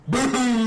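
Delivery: loud, high shouted boom; explosion sound. Pretend. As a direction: yell the blast as it goes off. Mimics grenade explosion.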